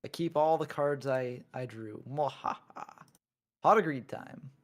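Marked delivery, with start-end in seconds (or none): 2.1-4.5 sneering panto villain. Pretend. Gloating over drawing cards.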